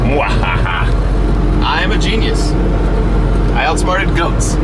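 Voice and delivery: hammed-up evil genius gloat; mad scientist. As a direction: cackle then gloat, villain-style. Villain laugh after tricking goats.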